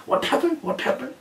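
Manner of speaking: high, urgent repeated question; anxious father. Retold or imitated. Father urges his daughter to speak, high and anxious. Father voiced in retold story.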